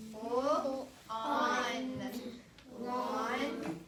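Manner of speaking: teacher sounding out a word for the class. Shown as a demonstration. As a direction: spell out "Pull on lawn" slowly, each sound apart. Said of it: "Let's all do that together one more time".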